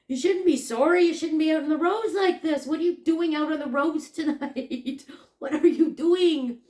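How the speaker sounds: loud, high retold telling-off; exasperated scolding. Customer to driver out on icy roads, exasperated scolding.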